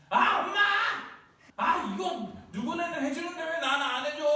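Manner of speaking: put-on high, loud kid complaining to mom; whiny child. Child nagging mom for what others get, high whiny complaint. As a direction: whine like a spoiled kid, laughing through it.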